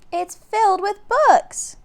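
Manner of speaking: put-on a girl thrilled, calling down; excited shout. A narrator's reading.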